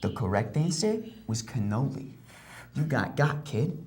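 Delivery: deep, quiet game-show host verdict; low, soft mock host; stern host. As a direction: dismiss the loser coldly.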